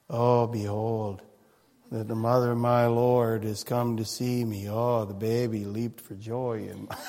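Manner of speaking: drain every bit of joy from it, mockingly; flat bored mockery. Preacher mocking a joyless Elizabeth greeting Mary, low flat drone. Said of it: "Try and say that without a smile on your face".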